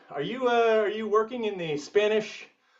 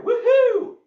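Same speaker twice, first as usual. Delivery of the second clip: high, loud woohoo like a skier; cheering woohoo; 7 dB louder than usual. Real, not acted. Coach whooping like a downhill skier to the class, high. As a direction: whoop down the slope.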